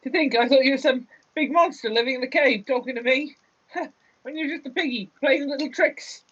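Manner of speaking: high, quick character voice; amused, relieved Gan. Acted. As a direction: scoff at your own scare in a high voice. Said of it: "breaks down laughing".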